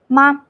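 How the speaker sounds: "ma" short and light; just a brief touch on "ma"; neutral tone. Shown as a demonstration. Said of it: "very short and very light".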